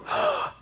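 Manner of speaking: sharp high gasp of fright; panicked gasp. Retold or imitated. Teacher acting out the panic of a phobia. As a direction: suck in air like sudden panic.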